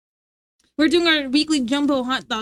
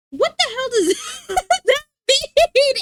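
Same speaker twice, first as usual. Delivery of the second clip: high, slow, laughing through the words; amused disbelief breaking into laughter; laughing question; 10 semitones higher, 4 dB louder, slower than usual. Real, not acted. Laughing at a chat joke.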